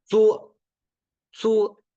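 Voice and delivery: zu said high and loud with the Mandarin tone. Shown as a demonstration. Teacher models zu for the class. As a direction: punch out zu clearly.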